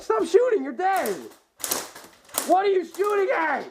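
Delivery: angry shout. Real, not acted. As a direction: yell at him to stop. Yelling at player shooting dead.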